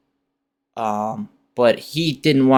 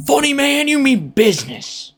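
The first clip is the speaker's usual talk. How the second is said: pitched way up, excited; high hype voice; 12 semitones higher than usual. Shown as a demonstration.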